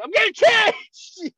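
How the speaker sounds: high, loud, drawn-out mock yell; retold panicked screech; panicked excuse. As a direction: act caught red-handed and shriek an excuse. Caught by grandma, acts panicked excuse.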